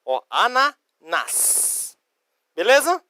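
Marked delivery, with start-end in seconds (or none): 0.3-2.0 "ananás" very slow, in parts, long final "nás"; stress on last A. Shown as a demonstration. Teacher repeats the accent for students, "ananás" slowly split. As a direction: stretch "ananás" out to land on NÁS. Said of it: "Acento no último A pra dar entonação".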